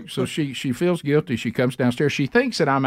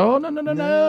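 comic protest; 13 semitones higher than usual. Retold or imitated. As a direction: wail the objection, hammed up. Retold refusal to let wife leave.

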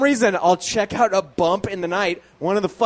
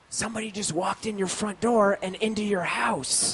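hushed; 3 semitones higher, 7 dB quieter than usual. Retold or imitated. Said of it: "And she said".

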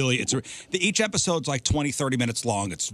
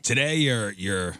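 normal talking voice

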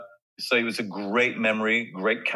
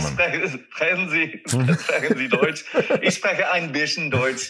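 German; 6 semitones higher, 3 dB louder, slower than usual. Pretend. Guest answers in slow German.

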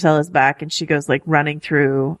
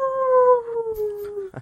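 a much higher, thin, drawn-out direwolf whimper; impression of Ghost; 4 dB quieter than usual. Host's embarrassing quote-of-the-episode impression, high whimpering direwolf. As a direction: play the silent direwolf with one high little oh. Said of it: "Here's, here's my impression".

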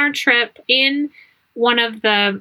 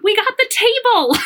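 gleeful announcement; 11 semitones higher than usual. Retold or imitated. Husband's gleeful message quoted.

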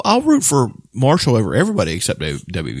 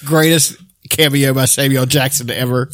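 normal talking voice

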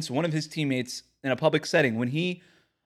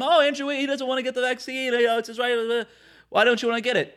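mocking voice; 8 semitones higher than usual. Mocking Twitter defenders.

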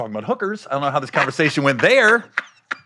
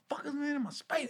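hushed, higher-pitched grumbling; angry under breath; 11 semitones higher, 17 dB quieter, faster than usual. Retold or imitated. Tenant scared to confront roommate, secretly pissed. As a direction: curse them so they can't hear. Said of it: "I'm pissed 'cause they're in my personal space".

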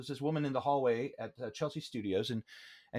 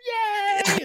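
very high, louder puppet squeal; Muppet cheer; 6 dB louder than usual. Retold or imitated. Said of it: "Like, like, Muppets".

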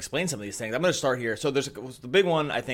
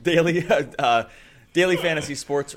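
hype voice; 6 semitones higher, 7 dB louder than usual. Acted. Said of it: "hype voice".

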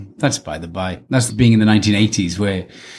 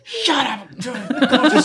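comic impatient outburst, pitched way up; mock exasperation; 14 semitones higher, slower than usual. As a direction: cut off a whiner in play. Acting out retort to whining.

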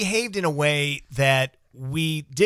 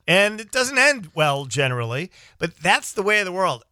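normal talking voice

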